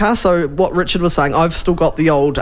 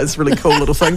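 ordinary talk

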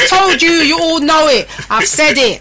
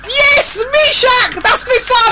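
excited; 11 semitones higher, much slower than usual. Real, not acted. Her son joins the live.

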